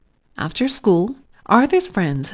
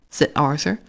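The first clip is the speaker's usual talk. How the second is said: ordinary delivery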